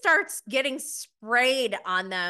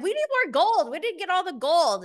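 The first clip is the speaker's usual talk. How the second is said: much higher, louder urgent mock voice; Trishelle imitated; 9 semitones higher, 5 dB louder than usual. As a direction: demand it in a high, pushy put-on voice. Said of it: "Trishelle's like".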